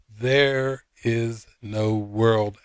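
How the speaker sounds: THERE IS NO WORLD drawn out word by word; slow, emphatic "There is no world", each word weighted; exclaimed quote. Retold or imitated. Friend quoting the lesson's exclamation, slow and emphatic. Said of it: "exclamation point".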